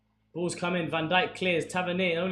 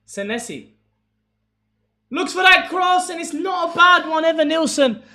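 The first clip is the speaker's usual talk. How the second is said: rising, much higher and louder play-by-play on the attack; live commentary; 7 semitones higher, 10 dB louder than usual. Acted. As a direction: call the chance like a TV commentator.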